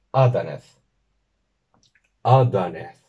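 "Adanez" stressed on the first syllable A; teacher modelling the Sindarin for woman. Shown as a demonstration. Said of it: "la tónica va a ser la primera".

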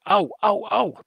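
quick high-pitched ow-ow-ow yelps; mock yelp. Shown as a demonstration. Trainer modelling a response to puppy teeth, mock yelp. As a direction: yelp as if a puppy nipped you. Demonstrates yelping at a biting puppy.

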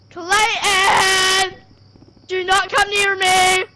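slow loud warning yell; shouting. Real, not acted. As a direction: yell it to keep him away. Scared, calling out to friend.

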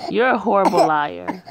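normal talking voice

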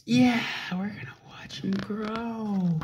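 soft, secretive whisper. Acted. Parent whispering encouragement to a child reading, hushed whisper.

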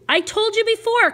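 ordinary delivery

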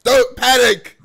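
loud panicked call; alarm shout. Pretend. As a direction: yell it like a fire alarm.

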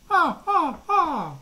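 high, loud mocking cackle; crows cackling. A narrator's reading. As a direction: crows laugh at the stuck-on feathers. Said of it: "The crows cackled".

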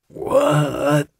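a big man waking groggy and confused; dazed question. Acted. Dawson wakes up under the gun, dazed rising question.

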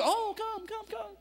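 high, coaxing mock welcome; hammed-up fawning invitation; mock eager welcome. Hypocritical crowd fawns over the blind man, mock eager welcome. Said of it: "now Jesus goes".

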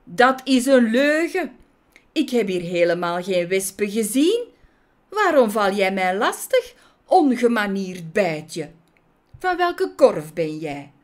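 put-on loud, affronted old judge; acted outraged accusation; indignant scolding. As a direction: berate the little bee as a nuisance. Judge angrily scolds Maya.

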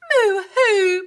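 high, loud moo-hoo; loud tearful moo; bawling cow. A narrator's reading. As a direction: wail it like a crying cow. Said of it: "bawled Cow".

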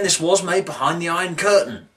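normal talking voice

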